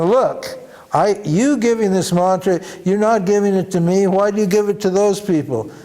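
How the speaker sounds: irritated demand. Retold or imitated. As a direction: push back at the guru, annoyed.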